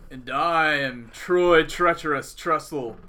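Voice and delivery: slow, spooky host voice; horror host. Acted. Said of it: "dispense with the craziness".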